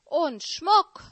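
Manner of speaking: high greedy squawk; croaking magpie. Acted. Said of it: "krächzte die Elster".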